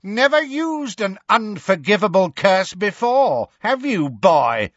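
high, scornful yell. A narrator's reading. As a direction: yell mockingly at an inexperienced foe. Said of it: "she yelled".

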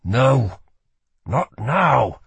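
strained, desperate plea; desperate refusal; pleading. A narrator's reading. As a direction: plead with your own failing body. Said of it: "he pleaded with his body".

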